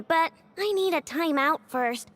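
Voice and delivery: ordinary delivery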